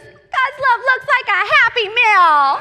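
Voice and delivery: loud, high, drawn-out shout; slow, exuberant proclamation; overjoyed child. Acted. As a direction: yell it out with a kid's glee. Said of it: "And he goes".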